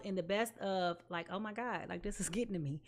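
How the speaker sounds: quiet, choking up a little; overcome, words catching softly; getting emotional. Real, not acted. Reader moved while sending viewers well wishes, softly choked up. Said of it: "I'm getting emotional".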